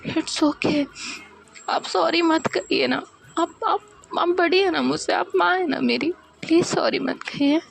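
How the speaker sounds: high, choked voice close to crying; tearful daughter. Acted. Daughter opening her heart to her mother, high and tearful. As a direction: pour it out through tears.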